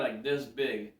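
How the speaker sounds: normal talking voice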